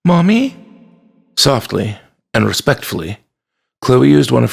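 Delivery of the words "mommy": put-on high little-girl voice, rising; worried child calling. A narrator's reading. Chloe calling her feverish, still mother, high questioning. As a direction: lift it high into an uncertain question.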